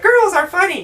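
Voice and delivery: high-pitched mocking cheer; mock point-proving. Moviegoer proving a point, high mocking voice. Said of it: "be like".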